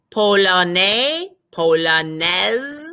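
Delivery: polonais with a silent ending, then polonaise ending in z; drawn out polonais then polonaise with z; slow polonais, polonaise. Shown as a demonstration. Teacher models the two forms slowly. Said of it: "the E after an S gives it a Z sound".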